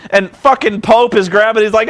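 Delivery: normal talking voice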